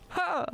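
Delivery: sharp high "Ha!"; mock laugh. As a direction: bark out one high laugh. Grocer laughs in the joke.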